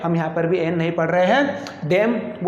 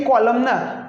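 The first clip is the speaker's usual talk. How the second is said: ordinary talk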